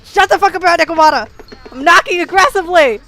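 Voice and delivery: loud high frantic shouts; panicked yelling. Real, not acted. Streamer dreading the wrong character, loud frantic shouts. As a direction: yell in a panic. Losing fifty-fifty tension.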